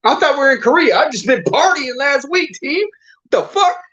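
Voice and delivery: high-pitched, laughing, frantic protest; panicked disbelief. Retold or imitated. Soldier retells his panic on the missile convoy, high and laughing. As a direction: yelp it like a partying kid thrown into a war. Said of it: "I'm like".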